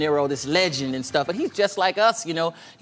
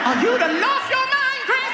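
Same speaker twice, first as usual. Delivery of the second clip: high loud mock scold; mama scolding; 3 dB louder than usual. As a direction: snap at him like a church mama. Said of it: "mama in church".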